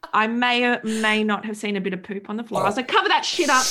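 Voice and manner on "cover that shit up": high, loud retold command; urgent mock order. Mother in labour ordering the poop hidden, high and loud. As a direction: call it out in comic alarm.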